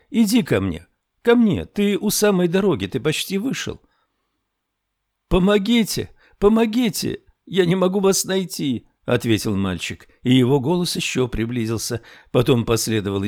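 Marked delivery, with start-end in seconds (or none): 0.1-3.8 put-on high, urging call to a child. A narrator's reading. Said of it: "позвала она". 5.3-8.9 put-on high-pitched child pleading for help; lost boy calling. A narrator's reading.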